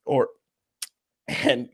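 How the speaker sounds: ordinary delivery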